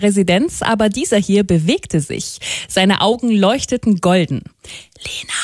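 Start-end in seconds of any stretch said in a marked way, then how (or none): 5.0-5.3 a soft whisper, much quieter. A narrator's reading. Lion calling Lena at her window at night, whispered. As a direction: whisper the name like a secret call. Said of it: "flüsterte der Löwe".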